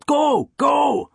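high, loud, urgent cheer; shouted. A narrator's reading. Cello teacher spurring student through the piece, shouted. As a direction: spur the player on, shouting high. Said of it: "Jensen shouted".

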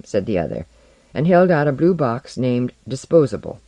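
usual speaking voice

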